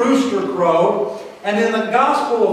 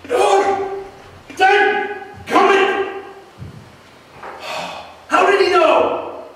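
wept bitterly; 10 semitones higher than usual. Shown as a demonstration. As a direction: cry out in remorse like weeping Peter. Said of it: "Sounds like maybe something like this".

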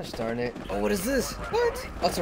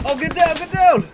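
high loud take-cover yell; shouted warning; 7 semitones higher, 6 dB louder, slower than usual. Acted. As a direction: warn everyone to drop. Taking cover in game.

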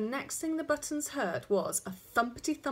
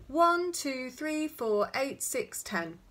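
put-on toddler counting; 5 semitones higher, 4 dB louder, much slower than usual. A narrator's reading. Toddler counting fingers wrongly.

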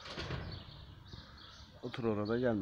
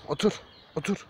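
high, louder repeated sit command; firm commands; 7 semitones higher, 7 dB louder than usual. Real, not acted. Ordering the excited dog to sit, firm and loud. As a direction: bark each word out to make the dog obey.

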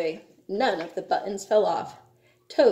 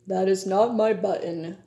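ordinary delivery

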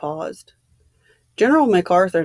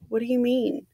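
higher, mildly unsettled question; unsteady; 4 semitones higher, 4 dB quieter than usual. A narrator's reading. Vera questions the general's talk of the end, unsteadily. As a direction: ask it with a touch of unease. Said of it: "She said unsteadily".

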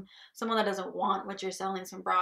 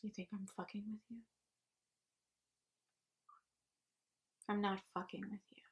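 soft, quick, contained threat; quiet menace; 8 dB quieter, faster than usual. Acted. Boss warning the salesmen he is serious, quiet menace.

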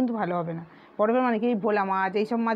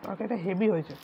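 talking between bites; chewing while speaking; mouth full of food. Real, not acted. Seen 0: mid-meal, mouth working on food while mixing rice by hand.

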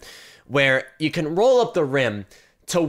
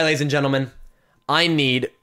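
normal talking voice

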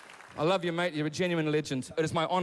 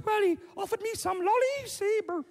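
very high little-daughter voice; squeaky-high mock daughter telling her story; upset daughter. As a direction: put on a high-pitched upset girl. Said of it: "And she goes".

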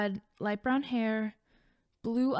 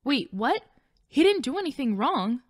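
alarmed plea; 5 semitones higher, 5 dB louder than usual. Acted. Destiny fears Ray will hurt Mike.

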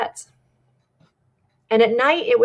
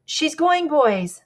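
higher-pitched quoted call to the crew; 7 semitones higher, much slower than usual. Retold or imitated. Shackleton rouses the crew from their tents, calling out. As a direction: call it out, raised pitch but only mildly projected. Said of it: "He called out".

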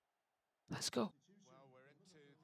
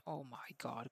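ordinary delivery

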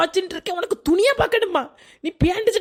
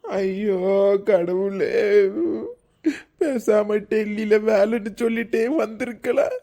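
comic crying; 9 semitones lower, slower than usual. Acted. Husband crying to God.

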